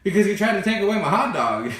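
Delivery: put-on high, loud indignant kid; frustrated outburst. Boy justifies biting to babysitter, loudly frustrated. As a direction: blurt it like an aggrieved child, hammed-up. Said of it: "how frustrated I was".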